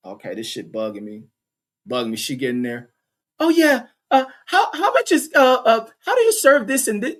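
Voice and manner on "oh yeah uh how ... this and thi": high, put-on polite ordering voice; mock a prim customer voice; imitating her proper voice. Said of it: "looking at her voice".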